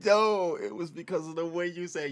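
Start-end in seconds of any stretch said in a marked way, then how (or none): 0.0-0.5 loud burst. Real, not acted. Bursting in to object.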